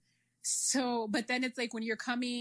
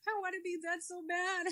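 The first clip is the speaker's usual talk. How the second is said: high, soft, half-crying whine; mock weepy whine; 9 semitones higher, 4 dB quieter than usual. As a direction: whimper it, high and hushed. Exhausted runner's inner voice.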